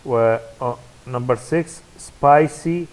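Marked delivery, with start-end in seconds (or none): 2.0-2.9 spicy punched louder on SPI; stress on first syllable. Shown as a demonstration. Teacher going through the word list, spicy stressed on SPI. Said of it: "on the first syllable".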